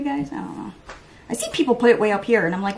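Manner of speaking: usual speaking voice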